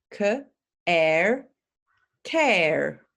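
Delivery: care broken into K and air; sounded out care. Shown as a demonstration. Teacher breaking care into sounds for learners. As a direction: split care into K then air. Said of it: "And care".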